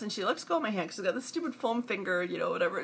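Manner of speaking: ordinary talk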